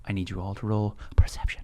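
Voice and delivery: soft unvoiced whisper. Real, not acted. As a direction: hush the table. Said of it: "whispered instruction".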